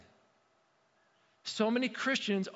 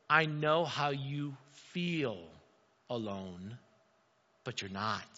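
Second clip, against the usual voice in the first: tender reassurance; 6 semitones lower, slower than usual. Acted. God reassuring lonely Elijah, slow and tender. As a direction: speak it gently like a hand on the shoulder. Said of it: "Basically, God's saying".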